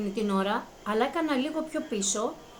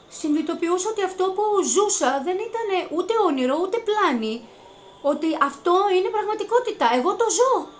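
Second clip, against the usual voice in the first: overwhelmed; 7 semitones higher, 5 dB louder than usual. Real, not acted. Realising the miracle is real.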